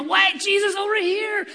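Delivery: high, loud frantic shout for help; frantic call. Retold or imitated. Disciples frantically calling to Jesus in the storm, fearful shout. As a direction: call out in fear over the storm. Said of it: "frantically, fearfully waving".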